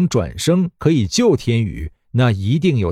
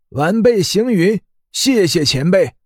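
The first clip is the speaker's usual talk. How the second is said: put-on high, timid young disciple; deferential trembling; 6 semitones higher than usual. A narrator's reading. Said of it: "诚惶诚恐的道".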